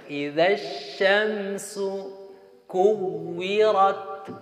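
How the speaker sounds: slow drawn-out Arabic recitation of "kuwirat"; Quranic recitation example. Shown as a demonstration. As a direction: recite "Idza syamsu kuwirat" slowly like Quran reading. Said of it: "Contoh yang sering dibacakan".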